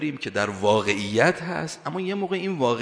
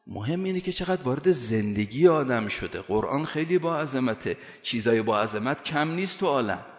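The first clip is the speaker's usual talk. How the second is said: usual speaking voice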